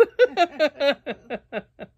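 giddy relieved laughter; laughing. Real, not acted. Laughing after near-choking.

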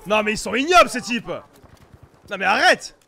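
loud exasperated outburst; fed up, drawn-out arrête; outraged. Real, not acted. Outraged at enemy snipers. (indigné, à pleins poumons)

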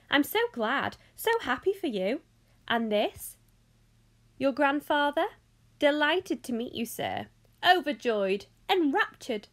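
effusive host with swooping pitch; lively, emphatic eccentric showman; excited Mister Wonka. Acted. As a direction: gush with delight, then rally the group. Voices excited Mister Wonka greeting Charlie.

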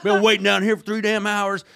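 hammed-up angry driver yelling at the owner's rep; a laughing imitation of a furious shout; retold yelling. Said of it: "he starts yelling at her".

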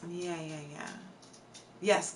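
ordinary talk

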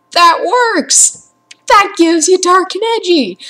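high, loud rant. Real, not acted. Gamer ranting about dark and edgy scenes. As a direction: hammer the point home.

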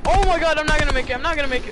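loud high panic; panicked. Real, not acted. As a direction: shout it in a panic. Falling into water, panicking.

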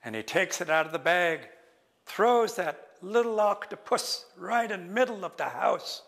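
ordinary delivery

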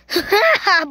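brief high laughing burst; quick, high giggle. Real, not acted. Gamer laughs at Karma, high laugh.

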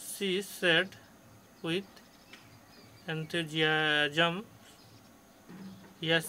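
slow, word-by-word classroom reading; reading aloud. Real, not acted. Teacher reading a passage to pupils, slow and deliberate. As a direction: read it out haltingly, with long pauses between words.

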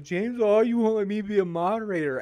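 high, whiny put-on voice of a weepy fired moderator; hammed-up sob story, begging James; mock crying whine. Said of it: "She was crying".

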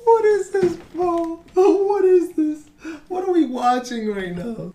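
very high, squeaky disbelief; incredulous squeal. Real, not acted. Gamer asking what we are watching, incredulous squeal.

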